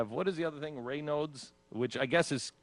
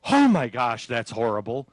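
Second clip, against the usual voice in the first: loud mock horror, easing off; acted gasp of dismay; horrified outburst; 9 dB louder than usual. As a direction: burst out appalled. Voicing an imagined horrified reaction.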